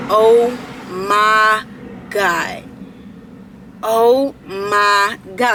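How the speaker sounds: slow, drawn-out disbelief; mother's dismay imitated. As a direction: stretch each word like a stunned mom overheard. Overheard mother reacting to test.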